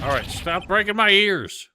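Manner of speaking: high, drawn-out loud complaint; loud protest. Acted. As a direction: yell it slowly over the noise. Protesting at a painful noise.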